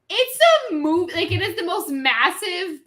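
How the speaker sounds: high, loud, retold disbelief; incredulous explaining. Explaining Star Wars to a clueless stranger, incredulous. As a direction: stretch it out as if stating the obvious. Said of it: "literally was taken aback".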